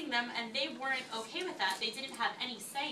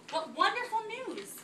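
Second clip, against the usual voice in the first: higher, bright burst of good news; excited announcement; 9 semitones higher than usual. A narrator's reading. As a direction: announce it like joyful news to the class. Teacher reading history book aloud.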